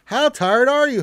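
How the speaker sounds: ordinary talk, nothing marked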